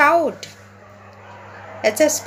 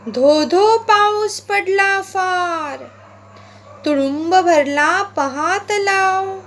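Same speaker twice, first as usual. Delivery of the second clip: slow, sing-song poem reading with a swinging rhythm; poem recitation; 3 semitones higher, slower than usual. Acted. Teacher reading the frog poem aloud to pupils, rhythmic recitation. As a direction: recite it aloud for the class to follow, stretching the croaks.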